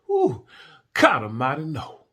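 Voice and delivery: high, loud whoops of revulsion; disgusted exclamation. Real, not acted. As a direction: recoil from the stink in loud high bursts. Recalling a revolting breath smell.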